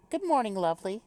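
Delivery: put-on little British accent. Reader voices a game character's morning greeting, light British accent. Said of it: "I wanna do a little British accent".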